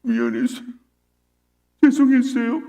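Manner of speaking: imitated crying child, high and whimpering; high-pitched mock weeping; tearful apology. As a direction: mimic a sobbing kid saying sorry. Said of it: "애가 갑자기 울면서 이렇게 얘기하는 거예요".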